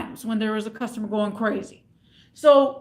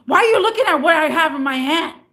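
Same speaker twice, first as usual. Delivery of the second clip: loud, higher irate-customer impression; retold yelling; 4 semitones higher, 6 dB louder than usual. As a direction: demand it loudly, as the customer. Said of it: "He yells at me".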